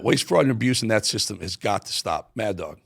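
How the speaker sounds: ordinary talk, nothing marked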